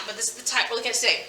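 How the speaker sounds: ordinary talk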